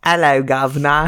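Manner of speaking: a hammed-up British accent; mock British accent. Teasing a friend about accents, mock British accent. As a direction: put on a mock British accent for Hello, governor. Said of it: "like, a British accent".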